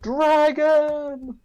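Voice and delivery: high, drawn-out yell; in-character yell. Acted. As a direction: yell it out high, like a warning cry. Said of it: "And I wanna yell".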